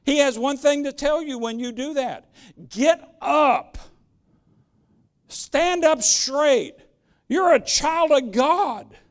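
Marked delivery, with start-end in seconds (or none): 2.7-9.0 higher, slow, emphatic order; strong, deliberate preacher's exhortation; firm rousing command. Acted. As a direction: rouse a cowering child to stand tall. Voicing God's command to the cowerer.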